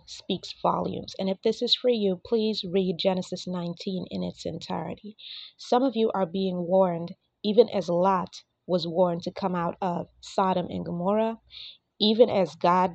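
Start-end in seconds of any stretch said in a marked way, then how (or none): none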